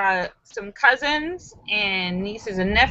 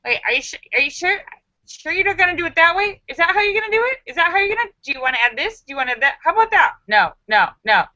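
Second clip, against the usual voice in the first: fussy, higher mock-mother nagging; imitating bossy mother; 7 semitones higher, much faster than usual. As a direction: play the fussing mother checking every step. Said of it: "my mother's like".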